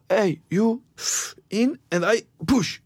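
high, slow, broken English of a carnival worker. Retold or imitated. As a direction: play the foreign carnival worker pushing you in, slowly. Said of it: "en marknad där ingen kunde svenska".

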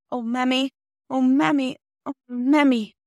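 high, pleading cry for mother, acted; distressed child. A narrator's reading. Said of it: "began to cry again".

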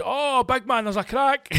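hammed-up high squeaky mechanic; RAC guy. Mechanic telling him about the crack, high mock voice. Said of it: "he goes".

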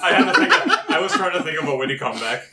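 laughing through the words; breaking up while talking. Real, not acted.

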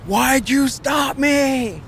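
high, drawn-out protest cry; retold yell. Robber yelling at the police who pulled the suspect over, high protesting yell. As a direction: yell it high, stretching the words. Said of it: "And I start yelling".

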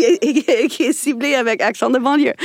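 laughing through the words; amused laugh while finishing the line; chuckling at the absurd label. Real, not acted. Laughing at the cliché.

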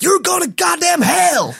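high, slow mock shout; damn them loudly down the phone; hate caller. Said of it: "getting all these hate calls".

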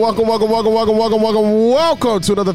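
hyped welcome. Real, not acted. Host greeting listeners at the show's start, hyped and high. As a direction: fire up the crowd with the welcome. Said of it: "hyped show opening greeting".

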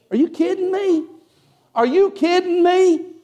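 high-pitched incredulous exclamation; amazed disbelief. Real, not acted. Preacher marvels at God's answer.

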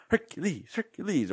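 high, silly catchphrase voice; high-pitched mock chant; Hercules joke impression. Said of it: "the way they do the Hercules joke".

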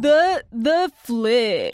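slow stretched DJ intro; cheesy radio announcer; radio host voice. Pretend. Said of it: "like we're radio hosts".

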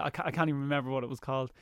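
ordinary talk

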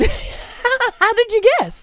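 high, slow mock amazement; mock surprise. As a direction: drag it out high, fake amazed. Mock astonishment.

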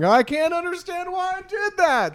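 very high-pitched, hammed-up lament; mock anguished wail. Podcaster joking about punching himself, mock anguished wail. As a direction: wail it in comic despair.

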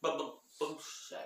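usual speaking voice

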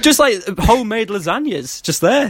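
high-pitched, amused amazement; laughing disbelief. Real, not acted. Laughing over the free buffet story.

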